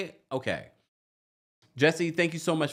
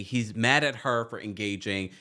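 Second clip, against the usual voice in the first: usual speaking voice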